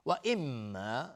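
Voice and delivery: اما with the doubled m held as a hum; ghunna. Shown as a demonstration. Teacher showing doubled meem, held nasal hum.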